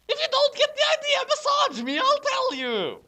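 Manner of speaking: high, fast mocking squeak of the fic author. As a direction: parody the author's note in a squeaky voice. Reading fanfic author's note mockingly.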